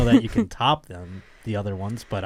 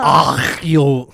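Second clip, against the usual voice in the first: put-on Israeli accent; 8 dB louder than usual. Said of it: "So it'd be more like".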